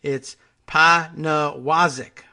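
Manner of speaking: Panawazik drawn out very slowly, PAN-uh-WAH-zik; reading out the pronunciation. Shown as a demonstration. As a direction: announce Panawazik slowly and deliberately. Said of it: "there's a pronunciation guide in the bio".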